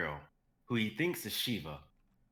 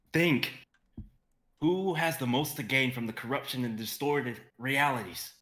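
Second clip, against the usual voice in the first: loud and higher, a sharp command to himself; urgent shout; 5 semitones higher, 5 dB louder than usual. A narrator's reading. Xavier shouting to himself as he plunges, urgent. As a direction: snap himself into focus.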